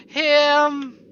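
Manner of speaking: loud, high exclamation of contempt; shocked outcry; loud disgusted him. Acted. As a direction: spit out the word at the visitor. Character sees unwelcome visitor.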